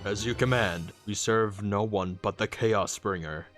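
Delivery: loud, devoted servant of evil; booming minion's vow; obedient henchman. Acted. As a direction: pledge loyalty loudly to your master. Henchman answers villain.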